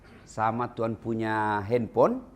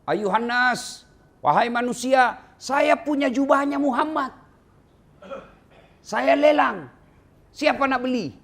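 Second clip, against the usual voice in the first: higher, louder hawker's cry to the crowd; market crier shouting; 11 semitones higher, 5 dB louder than usual. Retold or imitated. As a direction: call out the auction across the market. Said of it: "dia teriak-teriak". (teriakan pedagang lelang di pasar)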